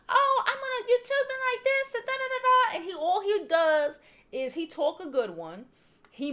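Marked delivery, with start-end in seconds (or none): 0.1-2.8 much higher and louder mock tirade; shrill-free, just loud and high imitation of a fed-up wife; mock yelling wife. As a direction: ham up a yelling wife's complaint. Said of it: "she probably yells and screams".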